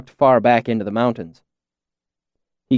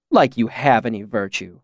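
ordinary delivery

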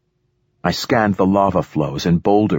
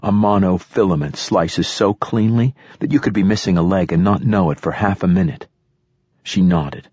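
ordinary delivery

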